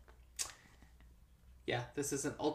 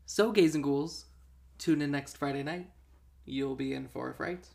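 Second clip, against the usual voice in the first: podcast sign-off; 4 dB louder than usual. Acted. Show sign-off catchphrase.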